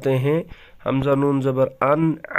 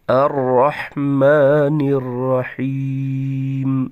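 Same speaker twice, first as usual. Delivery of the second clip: slow, melodic tajweed recitation; Quran recitation; much slower than usual. Shown as a demonstration. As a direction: chant each verse evenly, drawing out the vowels. Teacher recites Fatiha verse by verse.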